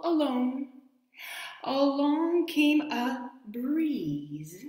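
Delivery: high, slow storybook climax; reading to children. Acted. Storyteller drawing out the finale for the children. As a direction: pitch it up and slow down for the last ladybug.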